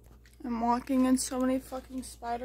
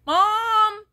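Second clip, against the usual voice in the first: loud high yell of Mom imitating a sister; Caitlin's scream; 9 semitones higher, 11 dB louder than usual. Parrot mimicking sister's scream, loud and high. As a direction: call out Mom like the kid sister. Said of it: "in Caitlin's voice, screaming".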